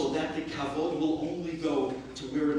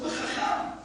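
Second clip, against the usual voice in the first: eager plea; 9 semitones higher, 6 dB louder than usual. Retold or imitated. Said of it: "I said".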